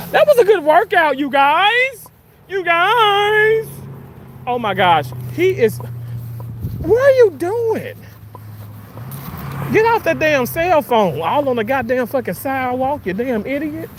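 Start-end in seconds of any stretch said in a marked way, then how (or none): 0.1-2.1 put-on high, loud and quick, a bubbly gym girl; high quick cheerful call; mock peppy cheer. 2.5-3.7 much higher, loud squeal on guys; high squeaky you guys. Pretend. Repeated even higher. 5.3-13.9 yelling at driver. Real, not acted.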